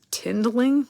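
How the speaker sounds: Tindling? asked high with a rising, baffled tune; amused disbelief at Tindling?; incredulous. Real, not acted. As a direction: repeat Tindling? in disbelief at your own slip. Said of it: "Why did I say tindling?".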